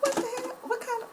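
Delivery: high, incredulous what the hell; stunned squeak of disbelief; shocked disbelief. Retold or imitated. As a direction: replay your own shocked reaction, way up high. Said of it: "And I'm like".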